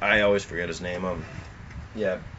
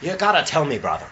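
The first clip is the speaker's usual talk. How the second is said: a loud mock Irish brogue, raised pitch; hammed-up Irish drinker; Irish accent; 7 semitones higher, 5 dB louder than usual. Friend mocking an Irishman at the pub, loud Irish accent. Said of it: "your accents are fucking nuts".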